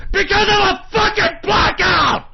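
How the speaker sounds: real high-pitched enraged scream; loud, high, exploding with fury; furious shout. Real, not acted. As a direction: scream the cause in pure rage. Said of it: "I'm so fucking pissed".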